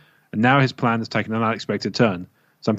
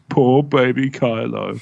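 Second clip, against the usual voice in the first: put-on slow, babying pity voice; mock pity; much slower than usual. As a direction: coo it like to a baby. Mocking pity for Kylo.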